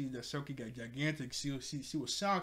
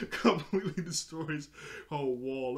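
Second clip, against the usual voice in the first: real giggle running into the words; amused, breaking up; laughing while talking; 4 dB louder than usual. Real, not acted. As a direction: say it through laughter. Laughing at the scene.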